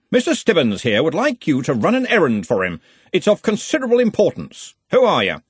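put-on loud, hearty old wizard; booming, confident wizard boss; bluff archchancellor. A narrator's reading. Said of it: "Ridcully voiced in story".

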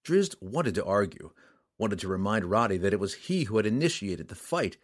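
usual speaking voice

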